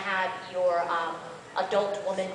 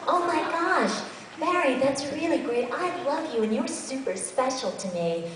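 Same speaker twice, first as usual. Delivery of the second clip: put-on higher, sweet gushing girl voice; lightly voiced adoring young woman; gushing heroine; 7 semitones higher than usual. Shown as a demonstration. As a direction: gush over Barry sweetly. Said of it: "You wanna have your heroine on there".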